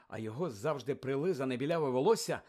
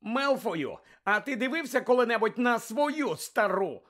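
mocking retort; 8 semitones higher, 5 dB louder than usual. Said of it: "спитав Гаррі".

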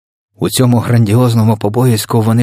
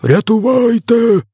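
very high, long-drawn scream for help; yelling; 4 dB louder, slower than usual. A narrator's reading. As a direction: stretch the cry out way up high. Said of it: "репетував тілогриз". (протяжний пронизливий крик)

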